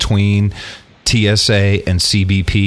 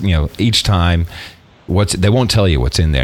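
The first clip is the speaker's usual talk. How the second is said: ordinary delivery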